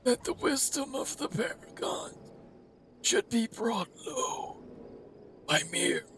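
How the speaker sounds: a menacing otherworldly whisper; ominous villain voice. Acted. Villain voiced in game cutscene.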